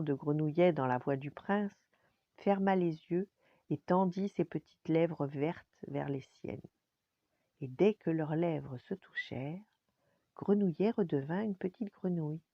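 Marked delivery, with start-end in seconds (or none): none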